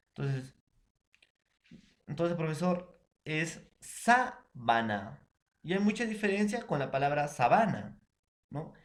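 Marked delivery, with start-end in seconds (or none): 3.8-5.2 sábana with the first syllable SÁ stretched and stressed, said very slowly; teacher demo stressing SÁ in sábana. Shown as a demonstration. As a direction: drawl out sábana, leaning on SÁ. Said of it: "intensidad en su pronunciación en la antepenúltima".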